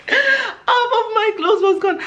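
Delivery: laughing in disbelief at the memory. Retold or imitated. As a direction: squeal it through laughter. Repeated, high with laughter.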